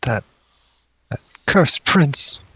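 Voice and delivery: high, distressed character voice; weepy mother. Acted. Mother tells visitors of her bewitched daughter, weepy. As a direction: lament in a high, weepy pitch.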